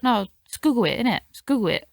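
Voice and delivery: "Google" said as "guggle"; "Google" as GUH-gul, offhand; dad's mispronunciation. Retold or imitated. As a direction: imitate dad sticking to "Google" with a short vowel. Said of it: "as my dad likes to pronounce it".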